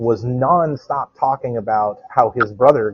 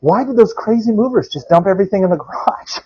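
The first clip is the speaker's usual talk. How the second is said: family puzzled; 9 semitones higher than usual. Retold or imitated. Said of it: "everybody else is like".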